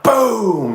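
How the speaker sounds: sudden high boom imitating a helmet smash; mock crash sound. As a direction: blurt the bang out like a crash. Sudden noise in the dugout.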